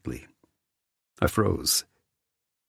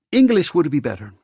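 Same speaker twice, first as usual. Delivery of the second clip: high, loud host voice; flamboyant host; 11 semitones higher, 6 dB louder than usual. A narrator's reading. Theo asks Nick for English, flamboyant. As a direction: pipe up in a showy, lifted voice.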